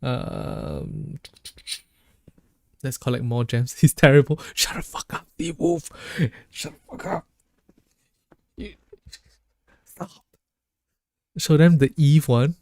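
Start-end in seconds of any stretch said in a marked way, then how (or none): 4.5-5.9 playful whisper. Real, not acted. Teasing a chatter. 6.5-10.1 playful whisper. Real, not acted. Teasing a chatter.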